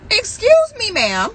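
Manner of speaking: loud, high "excuse me" falling on "ma'am"; indignant call-out. Acted. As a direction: confront her in mock outrage. Mock confronting the crying woman.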